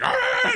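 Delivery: high, loud mock dog shriek; imitated dog outburst. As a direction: let out a sudden high dog cry. Imitates dog reacting to workers.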